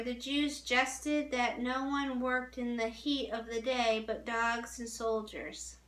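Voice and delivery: plain reading voice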